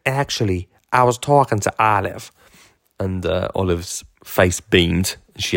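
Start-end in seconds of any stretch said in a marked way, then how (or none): 0.1-2.3 imitated American lady correcting him; American accent. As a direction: put on an American accent to set him straight. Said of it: "excuse the American accent, everybody".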